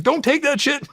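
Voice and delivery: high-pitched retold yell; urgent squawked warning, high in pitch. To the guy in the movie taking Ibogaine, yelled warning.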